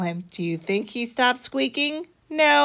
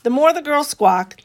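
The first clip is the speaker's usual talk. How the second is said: usual speaking voice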